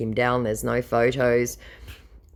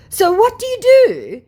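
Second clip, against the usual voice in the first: very high, loud mock question; mock scammer; 10 dB louder than usual. Scammer changing the subject, in a very high mock voice. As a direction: ham up his innocent diversion.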